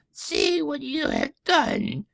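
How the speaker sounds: high, slow panicked witch voice; a melting witch shrieks in horror; high shrill witch scream of panic. Acted. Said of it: "she screamed".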